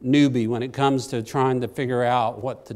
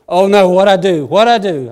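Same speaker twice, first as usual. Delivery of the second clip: loud, high panicked cry; 5 semitones higher, 10 dB louder than usual. Retold or imitated. Man panics as the coma patient convulses, loud panicked cry. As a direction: blurt it out in a retold panic.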